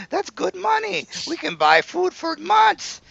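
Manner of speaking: very high eager servant voice; squeaky servant. Acted. Servant Jerome urges his master to take the money. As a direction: squeal it like a greedy little henchman.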